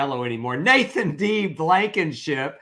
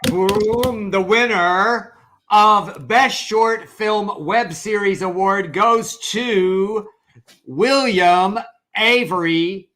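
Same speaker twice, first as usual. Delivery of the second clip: loud, slow winner reveal; announcer voice; 5 semitones higher, 5 dB louder, slower than usual. Pretend. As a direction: build it up like an awards host.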